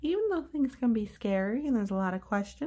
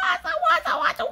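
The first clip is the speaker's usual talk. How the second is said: high, loud, rapid repeats; excited What; 8 dB louder than usual. Acted. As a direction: squeal it in delight. Pigeon thrilled.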